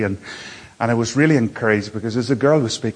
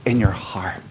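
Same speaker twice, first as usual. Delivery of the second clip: whispered; 5 semitones lower than usual. Real, not acted. Preacher drops to hushed point.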